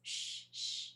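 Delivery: soft hushing whisper; whispering over a crib. Real, not acted. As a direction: shush her gently. Hushing the cat.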